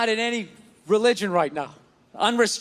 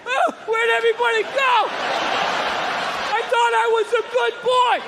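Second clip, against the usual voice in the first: put-on scared old man; 12 semitones higher than usual. Scared old man in a boat protests, high and bewildered. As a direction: plead like a confused old man. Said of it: "this scared old man getting yelled at".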